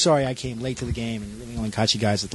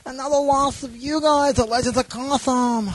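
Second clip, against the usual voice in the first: character voice; 10 semitones higher than usual. Acted.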